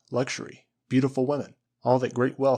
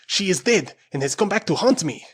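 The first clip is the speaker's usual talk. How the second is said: high, loud, horrified cry; terrified; 7 semitones higher, 7 dB louder than usual. A narrator's reading. Esteban facing Flora's ghost, loud terrified cry. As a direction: shout it as if seeing a ghost.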